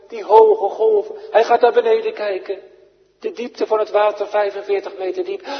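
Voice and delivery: fast, high, loud dramatic sermon telling; preacher crying out over high waves; fervent preaching. Acted. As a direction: race through it, building the storm's terror. Preacher dramatising Peter's fear.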